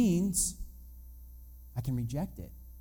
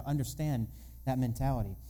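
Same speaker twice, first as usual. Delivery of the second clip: ordinary delivery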